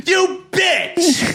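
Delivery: angry shouting. Real, not acted. Reliving the teacher's betrayal to a friend, real angry shouting. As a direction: yell it out, pissed off all over again. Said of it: "I was so pissed".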